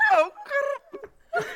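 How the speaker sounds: word lost in a burst of laughter; laughing. Real, not acted. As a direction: crack up mid-word. Cracking up at odd wording.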